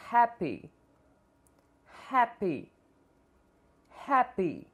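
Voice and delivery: Happy with an airy h, short a; English happy. Shown as a demonstration. Teacher models Happy for learners, airy h. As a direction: open Happy with a breath of h. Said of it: "Vamos tentar repetir cada palavra".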